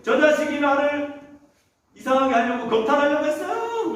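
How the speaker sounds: high-pitched, hammed-up outcry; acted screaming accusation. Master's wife accusing Joseph, acted screaming. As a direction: cry out as the wronged wife. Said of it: "아줌마가 막 소리 질렀어요".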